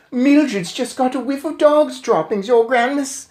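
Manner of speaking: high called reply; called back. Acted. Witch answering the queen, calling. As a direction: call back up to her. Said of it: "someone called back to her".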